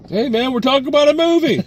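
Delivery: high, loud joking shout; mock outburst. Scolding the co-host mid-review, high mock shout. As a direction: yell it as a playful scold.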